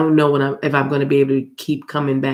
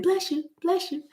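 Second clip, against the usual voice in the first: mock viewers' voices; 14 semitones higher, 8 dB quieter than usual. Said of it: "I can hear y'all saying".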